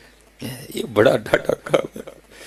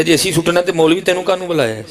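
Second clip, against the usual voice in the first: higher-pitched mimicked indignation; angry retort; 7 semitones higher than usual. Bereaved son rebuking the cleric who asked him to help, mimicked anger. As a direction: imitate an offended son's angry challenge. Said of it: "بڑے غصے میں کہتا ہے".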